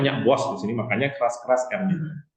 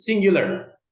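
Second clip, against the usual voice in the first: high, loud American "singular" with final R; American-style "singular", punched out higher and louder; American accent; 9 semitones higher, 6 dB louder, slower than usual. Shown as a demonstration. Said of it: "udah ke Amerika".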